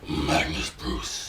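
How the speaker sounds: very deep, slow announcer voice; mock announcer. Co-host echoing the name, deep mock-announcer voice. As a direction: drop low and draw the name out.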